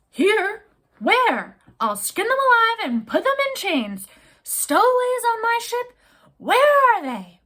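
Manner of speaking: captain in a fury hunting for stowaways. Acted. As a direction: rage in disbelief. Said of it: "In his fury".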